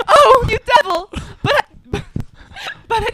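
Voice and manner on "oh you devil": high loud cry of pain and rage; stabbed man's furious cry; anguished cry. Acted. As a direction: cry out as the knife hits. Stabbed by the knife.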